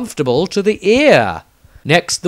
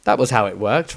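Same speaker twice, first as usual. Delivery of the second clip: usual speaking voice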